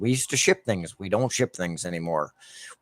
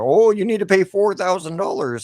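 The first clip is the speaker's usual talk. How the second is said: mock dentist; 10 semitones higher, 5 dB louder than usual. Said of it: "and they're".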